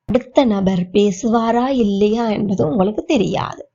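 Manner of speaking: ordinary talk, nothing marked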